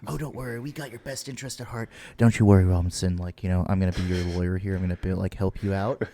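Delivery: smooth-talk the customer like a slick dealer; sleazy car salesman. Retold or imitated. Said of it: "having these people who mislead you".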